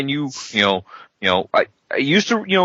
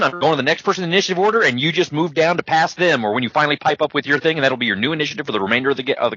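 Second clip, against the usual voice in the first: fast auctioneer; faster than usual. Shown as a demonstration. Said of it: "Start talking like an auctioneer".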